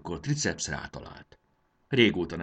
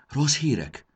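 high, louder startled question; Módusz, alarmed; 9 semitones higher, 6 dB louder than usual. A narrator's reading. Friend asks the leaders about bad news, alarmed. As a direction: ask it in sudden alarm, voice jumping up. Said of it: "kérdezte riadtan Módusz".

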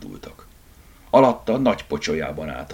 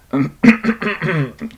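pointed little cough; embarrassed ahem; throat clearing; 9 semitones higher, 5 dB louder than usual. Acted. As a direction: clear your throat awkwardly. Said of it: "köhintett".

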